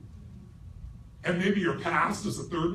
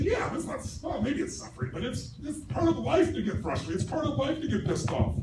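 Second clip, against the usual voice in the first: higher, defensive past-self voice; mock former self; 7 semitones higher than usual. His old self excusing frustration, higher mock voice. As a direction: squirm and justify it. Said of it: "I'd say".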